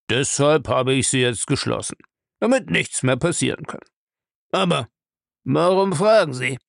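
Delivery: put-on a gruff old man's voice, evasive; gruff old castle owner. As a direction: play the old castle lord brushing off the question. Bellamy explains the locked door.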